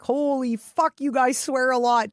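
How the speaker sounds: usual speaking voice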